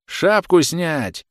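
slow, high, loud officer's order; shouted command. A narrator's reading. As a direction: stretch out a peremptory shout. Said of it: "крикнул офицер, прервав чтение".

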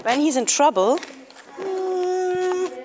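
mock-whimper the question back; whiny echo. Kid mock-whines the riddle back at the joke teller.

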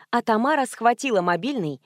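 ordinary delivery

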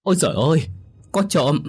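put-on old woman shrieking high in alarm; bà cụ hoảng hốt kêu to, giọng cao vút; panicked cry. A narrator's reading. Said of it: "Bà cụ ú ớ gào lên".

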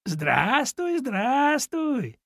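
high, smiling mother-rabbit greeting; good-natured. A narrator's reading. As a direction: greet him kindly, pitched well up. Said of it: "добродушно улыбнулась крольчиха". (добродушно, высоким голосом)

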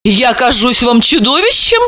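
a hint of proud defiance; proud challenge. Dalila challenging Anton, mildly defiant. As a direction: toss it back at him proudly. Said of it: "гордо откинув голову, с вызовом".

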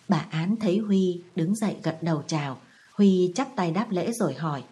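ordinary delivery

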